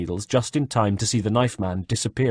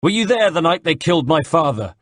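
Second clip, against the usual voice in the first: shouted accusation; 7 semitones higher, 4 dB louder than usual. A narrator's reading. Chasing the father's killer, shouting.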